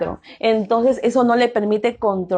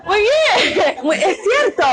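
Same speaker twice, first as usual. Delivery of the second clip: laughing through the words, pitched higher; amused laughter; 7 semitones higher than usual. Real, not acted. Teacher to class after a funny answer, laughing. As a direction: approve the answer while breaking into laughter.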